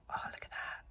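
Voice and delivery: hushed little whisper. Real, not acted. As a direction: breathe it out softly. Whispering in the car.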